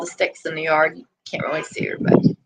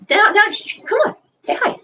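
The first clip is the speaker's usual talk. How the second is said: high, quick down-down to the dog; coaxing the dog; 11 semitones higher than usual. Real, not acted. Owner calming her excited dog, high coaxing voice. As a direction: settle her down and coax her along.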